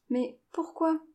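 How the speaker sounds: high, bewildered why; puzzled question. A narrator's reading. Jane asks grandfather about his strange instructions, puzzled. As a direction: ask it with the voice pitched well up.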